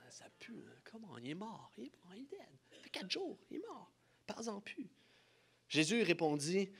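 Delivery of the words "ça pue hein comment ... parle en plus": very soft, half-whispered asides; hushed bystander murmur. Acted. Bystanders at the tomb murmur about the smell, hushed. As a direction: mutter it under your breath like an onlooker.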